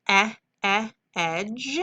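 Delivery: teacher modeling short e; short e sound. Shown as a demonstration. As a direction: isolate the short e, e, then say edge.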